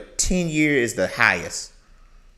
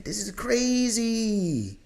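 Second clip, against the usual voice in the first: high, slow drawn-out this is crazy; stunned disbelief; 11 semitones higher, much slower than usual. Real, not acted. Host reacting to the sugar mama story, slow high disbelief.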